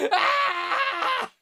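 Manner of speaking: normal talking voice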